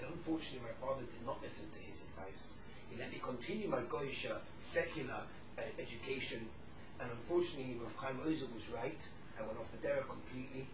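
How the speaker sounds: low, subdued retelling of regret; regretful confession. Retold or imitated. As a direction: imitate an old man confessing quietly. Old man's regretful confession, retold.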